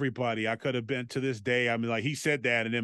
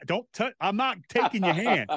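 higher-pitched mock-indignant refusal; retold annoyance; 7 semitones higher than usual. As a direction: act out refusing, half amused and put out. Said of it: "I'm getting mad".

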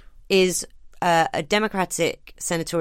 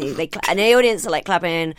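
normal talking voice

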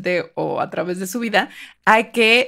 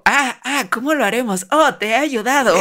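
higher, hammed-up infomercial gasp; over eager infomercial; 3 semitones higher than usual. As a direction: overplay the struggle like a TV ad. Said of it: "después de todo este infomercial".

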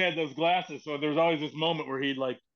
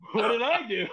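mock dismay; 6 semitones higher than usual. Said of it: "And I'm like".

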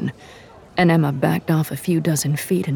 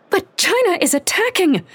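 high, heated cry; frantic protest. A narrator's reading. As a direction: push back hard, desperate. Heated argument with Miyako.

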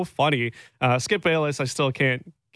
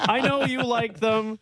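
high playful teasing; sing-song ribbing, slowly; 9 semitones higher, slower than usual. Real, not acted. Teasing texter.

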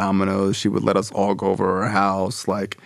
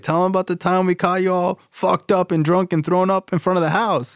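much higher-pitched imitation of a heckling dad; interrupting dad; 10 semitones higher than usual. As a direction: butt in like an eager dad from the audience. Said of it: "interrupt me and be like".

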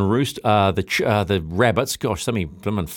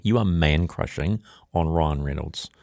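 "You are man crushing" said lower, slower and softer, mock-knowing; low teasing drawl; 6 semitones lower, 4 dB quieter than usual. Real, not acted. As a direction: tease him, dropping the voice low and slow. Teasing co-host.

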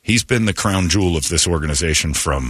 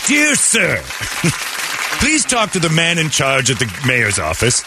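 high, prissy mock-formal letter voice; 7 semitones higher than usual. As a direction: ham up a stuffy, overly polite letter writer. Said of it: "Dear kind sir".

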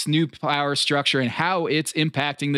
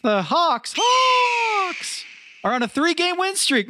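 high, slow theatrical shout; hammy stage actor; 14 semitones higher, slower than usual. Pretend. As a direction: proclaim it like a herald. Mock triumphant shout about Hawks.

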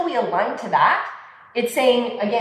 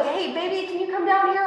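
put-on high and softer, gentle call to a child; soft mom voice; 8 semitones higher than usual. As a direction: coax him down kindly. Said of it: "get really soft with him".

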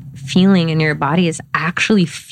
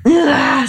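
open groan; 8 semitones higher than usual. Shown as a demonstration. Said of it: "that you're just, like".

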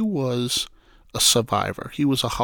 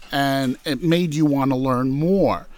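ordinary delivery

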